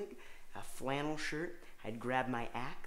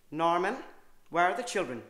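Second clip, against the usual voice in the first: loud mother voice; 5 semitones higher, 8 dB louder than usual. Pretend. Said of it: "do that kind of loud".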